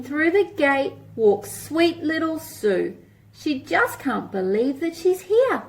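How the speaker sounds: lilting picture-book read-aloud; reading to children. Acted. Teacher reading a rhyming book to children, lilting. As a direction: read it like a storybook for little ones.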